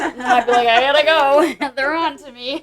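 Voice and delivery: talking through real laughter, high and loud; giggling while telling it; laughing. Real, not acted. Laughing through her own story.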